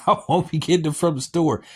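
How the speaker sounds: high-pitched, through a real laugh; laughter lifting the voice; amused. Real, not acted. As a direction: say it while chuckling. Laughs at his own remark.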